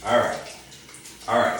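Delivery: normal talking voice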